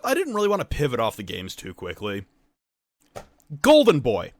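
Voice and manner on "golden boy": high, loud proclamation; indignant public shaming; loud scolding. Real, not acted. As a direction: call out the critics with a long, booming "Shame". Scolds critics of a host.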